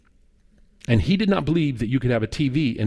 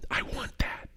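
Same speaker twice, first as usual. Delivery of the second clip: intimate, breath-only whisper; whispered prompting; 10 dB quieter than usual. Retold or imitated. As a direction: whisper it as a quiet inner summons. Voicing the Holy Spirit's quiet prompting.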